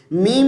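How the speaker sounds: میم as "mimmm", a long nasal hum; joined meem. Shown as a demonstration. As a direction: hold the closing m of میم through the nose. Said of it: "اس کے ساتھ ملائیں گے".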